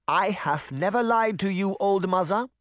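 put-on high, insistent accented voice; interrupting granny. A narrator's reading. Granny interrupting the witch's accusation, insistent. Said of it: "Granny interrupted".

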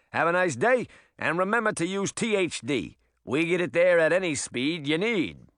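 high, brash little rabbit voice; fast-talking critter; pushy rabbit. Acted.

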